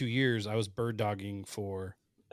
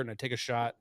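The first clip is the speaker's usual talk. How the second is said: usual speaking voice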